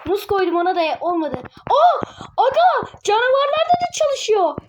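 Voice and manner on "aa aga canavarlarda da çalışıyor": high, loud excited squeal; real thrill, voice jumping high; excited shriek. Real, not acted. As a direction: yelp with delight at the discovery. Excited discovery while gaming.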